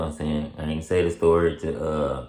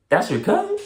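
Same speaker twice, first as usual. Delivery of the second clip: very high, louder surprised question; mock the cop's surprise, pitched way up; imitating the officer; 5 dB louder than usual. The officer, surprised, very high voice.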